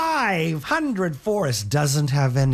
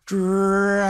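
louder call of his name; a little louder; 3 semitones higher than usual. Acted. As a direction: call again, a bit louder. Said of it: "Says a little louder".

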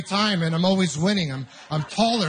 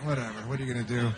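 lower, quicker, softer dismissive mutter; inner shrug; 6 semitones lower, 8 dB quieter, faster than usual. Retold or imitated. Comic thinking to himself after the kid's jab, low quick shrug. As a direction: brush it off under your breath. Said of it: "I thought".

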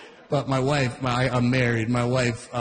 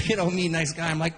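usual speaking voice

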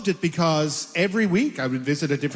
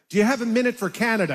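quick, higher street-reporter ask; reporter pitch; 5 semitones higher, faster than usual. Retold or imitated. Stopping Americans on the street, quick and bright. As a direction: rattle it off eagerly. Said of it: "And I'd say".

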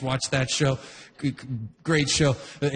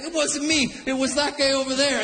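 high mock excuse; lightly voiced duck passing the blame; protesting duck; 9 semitones higher than usual. Beaten duck blaming the guy over there, high mock protest. Translates the tired duck's excuse.

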